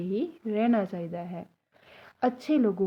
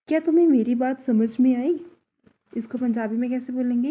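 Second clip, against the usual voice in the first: normal talking voice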